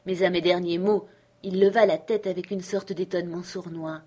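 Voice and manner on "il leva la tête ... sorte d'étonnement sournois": plain reading voice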